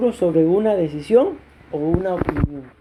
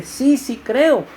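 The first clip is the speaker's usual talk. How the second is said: earnest reply; 6 semitones higher, much slower than usual. Acted. Man answers Jesus, slow emphatic.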